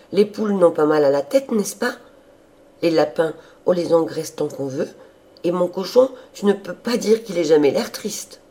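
high, loud, chattering enthusiasm; guileless eager sister. A narrator's reading. Sister defending her farm animals, high and emphatic.

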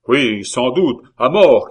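loud cry, peaking on the last words; shouted agreement. A narrator's reading. La Hurière joins the crowd's demand, shouting. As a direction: take up the mob's cry loudly. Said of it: "cria la Hurière".

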